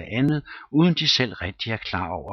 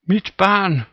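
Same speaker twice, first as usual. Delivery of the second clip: panicked shriek, much higher and louder; frantic cry; 11 semitones higher, 8 dB louder than usual. A narrator's reading. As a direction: scream for your child in terror. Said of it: "råbte missus Fleetwood". (panisk skrig)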